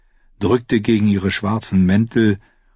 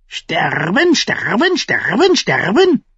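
squawking raven; 12 semitones higher than usual. A narrator's reading. Raven repeats the word.

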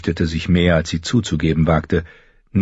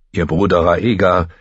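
usual speaking voice

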